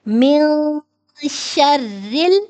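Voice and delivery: من شرل with a light, thin ra, the ending stretched slowly. Shown as a demonstration. Teacher demonstrating the thin ra in من شرل, slow and deliberate. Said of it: "ایسے رو کو باریک پڑھتے ہیں".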